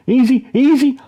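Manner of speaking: high, loud warning yell; dad yelling at the charging kid. A narrator's reading. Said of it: "I yell".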